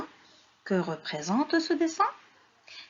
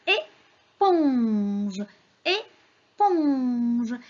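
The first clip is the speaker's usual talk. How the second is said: "éponge" split and stretched; long-held second syllable in "éponge"; sounding out; 4 dB louder, much slower than usual. Shown as a demonstration. Teacher names the picture for children, sounding it out.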